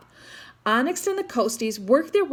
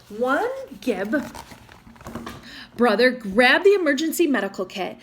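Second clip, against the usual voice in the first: ordinary delivery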